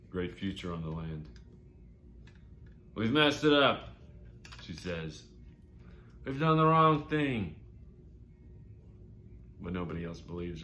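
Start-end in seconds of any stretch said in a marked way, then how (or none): 2.9-4.0 loud, high-pitched cry of regret; mother's loud lament. Acted. Mother's lament voiced in story. 6.3-7.6 loud, high, drawn-out wail of regret; mother's loud lament. Acted. Mother's lament voiced in story.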